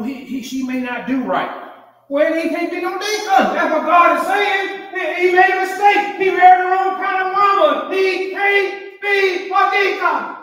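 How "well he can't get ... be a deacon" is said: high, loud proclamation, slowing at the end; preaching at full force. Real, not acted. Preacher declaring the man can't be a deacon, loud and high. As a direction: pound the verdict home to the church. Preacher drives home the point.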